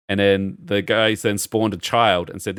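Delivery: ordinary talk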